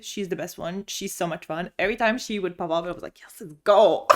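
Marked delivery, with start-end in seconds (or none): 3.2-4.1 loud, hyped-up cheer; excited cheer. Retold or imitated. Reader cheering whenever bestie Lauren pops up, excited cheer. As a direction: cheer on your favourite character, loudly.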